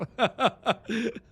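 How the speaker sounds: high-pitched burst of laughter. Real, not acted. Streamer amused by Texan accent story, laughing high.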